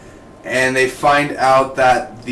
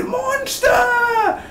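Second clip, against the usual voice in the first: high, slow, shrieking monster cry. Pretend. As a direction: screech it like a movie creature. Mocking the monster attack.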